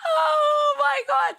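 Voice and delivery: very high, loud, slow shriek; shrieking oh my God. Real, not acted. Streamer reacting to the ad, loud delighted shriek. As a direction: scream it out in shock and delight. Screams at the commercial.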